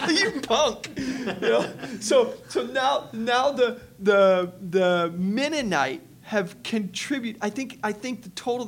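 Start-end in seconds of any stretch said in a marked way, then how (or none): 0.0-5.1 high, loud laughing jab. Real, not acted. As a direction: laughs out a teasing insult. Laughing at his friend's trick.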